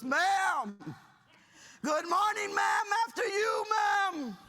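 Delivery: high, very loud military salutation; sergeant's shouted greeting. Retold or imitated. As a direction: sound off like a young sergeant to an officer. Acted sergeant greeting officer.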